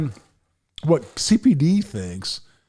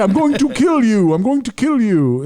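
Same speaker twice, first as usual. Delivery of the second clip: loud mock death threat in a Middle Eastern accent; high, forceful put-on Middle Eastern accent; 8 semitones higher, 6 dB louder than usual. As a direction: imitate a ranting caller with a Middle Eastern accent, pitched high. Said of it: "Middle Eastern accent".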